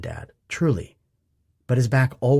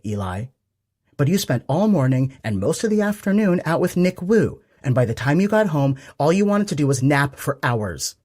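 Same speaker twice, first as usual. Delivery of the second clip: mom's voice; 6 semitones higher, 4 dB louder than usual. A narrator's reading. Mom reproaches son, rising.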